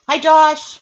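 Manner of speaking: high, loud happy hello; excited greeting. Real, not acted. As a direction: call out a bright hi. Greeting Josh joining live.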